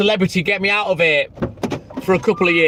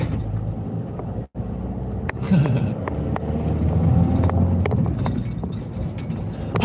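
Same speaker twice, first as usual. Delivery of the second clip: long helpless laugh, breaking up; deep wheezing laugh that rolls on; 5 dB quieter than usual. Real, not acted. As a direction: crack up at your own joke. Laughing at own joke.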